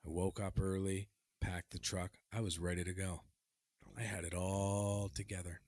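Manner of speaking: low, soft, deliberate storytelling; story narration. Acted. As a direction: tell it hushed, as a solemn tale. Hushed mock-solemn story narration.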